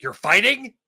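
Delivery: ordinary delivery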